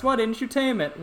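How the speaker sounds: lightly mocking high-pitched quote; complaining commenter. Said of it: "I may hear something in the comments".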